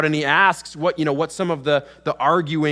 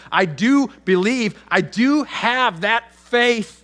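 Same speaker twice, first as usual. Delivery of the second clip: earnest plea; 6 semitones higher, 3 dB louder, slower than usual. Acted.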